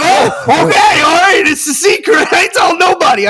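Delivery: high, hurried comic backpedalling; hammed-up alarm, pitch way up; mock panicked promise. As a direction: squeal your agreement as if threatened. Retold alarmed promise after threat.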